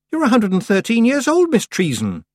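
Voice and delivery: put-on high, loud disbelieving girl; girl protesting. A narrator's reading. Girl protesting to the old witch, disbelieving. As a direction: plead against the idea.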